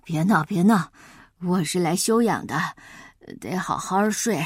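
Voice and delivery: low, sleepy grumble through pillow. Acted. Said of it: "用枕头捂住脑袋".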